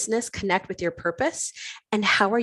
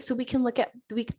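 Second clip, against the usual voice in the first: ordinary delivery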